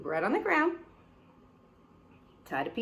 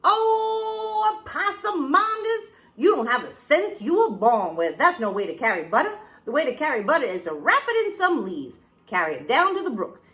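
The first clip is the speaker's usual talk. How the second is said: high, loud exasperated mother; scolding mama; 6 semitones higher, 6 dB louder than usual. Acted. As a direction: lay into the child like a fed-up mama. Mama lectures child.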